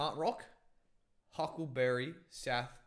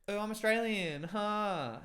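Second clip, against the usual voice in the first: put-on high, louder Australian eastern suburbs girl accent; 9 semitones higher, 4 dB louder, slower than usual. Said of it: "eastern suburbs Australian accent on a girl".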